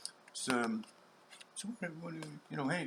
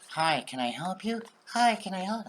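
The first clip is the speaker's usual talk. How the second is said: loud, high, overly chipper shout; eager Canadian greeting; 9 semitones higher, 9 dB louder than usual. Retold or imitated. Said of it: "some Canadian men".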